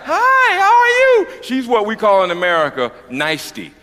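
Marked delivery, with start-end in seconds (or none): 0.0-1.3 high, bright-eyed mock sweetness; sugary high mock hello; sweet fake greeting. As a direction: chirp it up very high like a too-nice lady.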